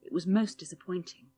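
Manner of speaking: ordinary delivery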